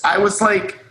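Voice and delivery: ordinary talk, nothing marked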